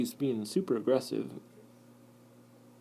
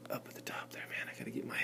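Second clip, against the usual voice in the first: hushed whisper; soft whispered mutter; whispered aside; 13 dB quieter, faster than usual. Real, not acted. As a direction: keep it under breath.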